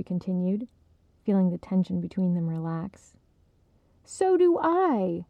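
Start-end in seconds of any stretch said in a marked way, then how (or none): 4.0-5.2 slow, much higher, eager agreement; emphatic. Acted. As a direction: agree with relief and gusto. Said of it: "Esther nodded emphatically".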